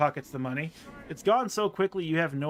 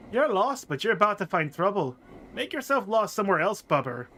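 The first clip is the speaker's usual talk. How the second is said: put-on higher accented warning, dropping at the end; prickly tailed girl; 4 semitones higher, 3 dB louder than usual. Girl sending a lost stranger away, prickly. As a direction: warn the stranger off in the girl's voice.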